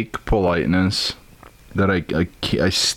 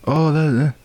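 put-on Southern accent; 7 semitones higher than usual. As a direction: imitate a sugary Southern lady. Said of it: "however the fuck you guys sound like".

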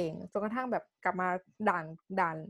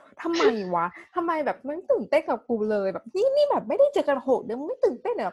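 higher, louder indignant rant; venting rant; 6 semitones higher, 5 dB louder than usual. Retold or imitated. Venting to her friend about her boyfriend, a loud rant. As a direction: rant at him in disbelief. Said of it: "ก็ด่าๆๆๆเขา".

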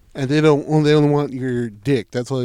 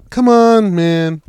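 higher and much louder protest; exasperated outburst; 7 semitones higher, 12 dB louder, much slower than usual. Retold or imitated. Complaining about a stepsister porn plot, loud exasperated yell. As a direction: throw up your hands and yell it.

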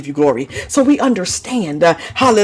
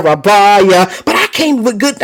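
ordinary delivery

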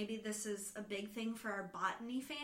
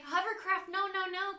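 high, acted-out panic; raised-pitch worried retelling; mock alarm; 8 semitones higher, 4 dB louder than usual. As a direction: play someone spotting danger, lightly hammed up. Acting out her alarm at hovercraft.

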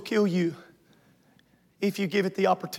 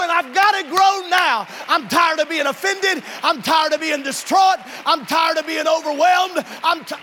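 loud, high, resolute proclamation; defiant declaration; 9 semitones higher, 6 dB louder than usual. Real, not acted. Congregation told to declare victory, loud and high. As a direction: shout it with squared shoulders. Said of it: "square your shoulders and say".

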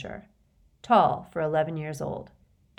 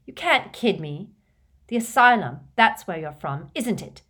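put-on angry, louder accusing boy; furious boy; 3 semitones higher, 7 dB louder than usual. Riddle accusing Dumbledore of the asylum, furious. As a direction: lash out at the stranger. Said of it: "looking furious".

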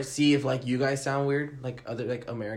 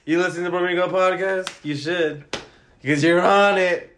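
loud, higher-pitched show host bit; mock radio host; 6 semitones higher, 7 dB louder than usual. Podcast host greeting the first caller on air, hammed-up hype. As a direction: play a hyped-up host, stretching the words.

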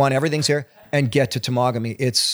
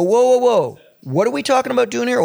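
alarmed objection; 9 semitones higher, 4 dB louder than usual. Retold or imitated. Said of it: "they go".

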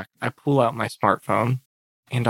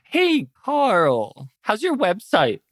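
high, singsong gloat; taunting; 8 semitones higher, much slower than usual. Acted. Hacker taunts Carl about his crashed website, mock-sweet. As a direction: tease him with a sugary, lilting question.

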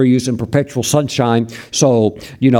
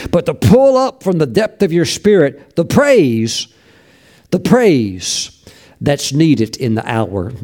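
preaching. Real, not acted. Preaching, slow emphatic build.

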